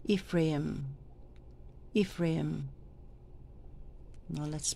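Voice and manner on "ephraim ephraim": "Ephraim" said softly and carefully as a model; careful pronunciation. Shown as a demonstration. Lector trainer shows readers how to say "Ephraim", careful pronunciation. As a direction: say "Ephraim" softly, as an example to copy.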